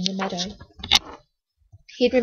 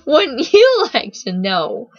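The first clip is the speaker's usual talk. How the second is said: slow, higher, loud teasing drawl; 4 semitones higher, 7 dB louder, slower than usual. A narrator's reading. Boy asked his name by Steffi, slow teasing taunt. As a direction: draw it out tauntingly, leaning on YOU. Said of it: "he teased".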